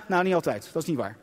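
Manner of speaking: ordinary talk, nothing marked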